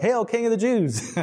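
high mocking sing-song salute. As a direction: sneer it like a soldier mocking a king. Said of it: "they're mocking Jesus".

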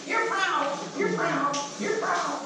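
high mocking devil voice, pitched well up; sing-song accusation from the devil; taunting devil. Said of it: "the devil comes along and says".

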